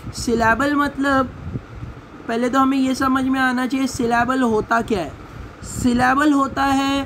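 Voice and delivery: ordinary talk, nothing marked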